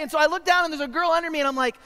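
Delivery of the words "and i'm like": ordinary delivery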